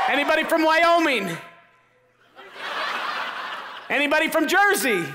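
polling the hall at full voice; calling out to crowd. Real, not acted. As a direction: shout questions out over the audience.

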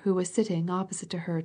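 plain reading voice

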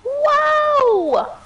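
loud high surprised "Wow". Real, not acted. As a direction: shout out in happy surprise. Snuck into third place.

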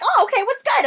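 high, loud challenge; mock squaring up, high; ready to fight. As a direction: call her out, up high. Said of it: "So I'm like".

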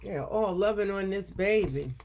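plain reading voice

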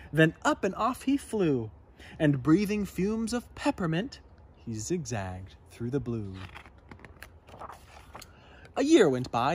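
ordinary delivery, nothing marked

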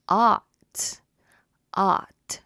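ought with open ah, loud; saying ahh. Shown as a demonstration. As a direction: stretch ought as ah. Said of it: "ought 발음입니다".

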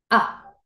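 quick, cut-off "A" as ah; short A sound. Shown as a demonstration. Teacher contrasting with Ey, short "A". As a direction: say "A" as one brief open ah. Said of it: "¿Cuál era el sonido corto?".

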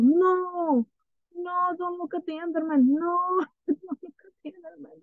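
high, frantic cries of no; laughing-crying panic; panicked pleading. Real, not acted. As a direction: beg yourself not to look at it. Enderman appears, panicking.

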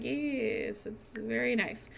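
soft, unenthused compliment; quiet polite humoring. Acted. Jasmine answering him about his silks, softly humoring. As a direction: praise it in a small, dutiful voice.

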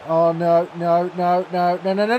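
normal talking voice

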